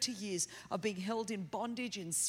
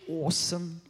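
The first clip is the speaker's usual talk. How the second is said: low, drawn Australian delivery; be Australian, pitched down low; Australian accent; 5 semitones lower, 4 dB louder than usual. Shown as a demonstration. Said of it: "be Australian".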